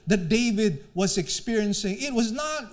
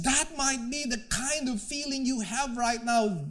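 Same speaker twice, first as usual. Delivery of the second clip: ordinary talk, nothing marked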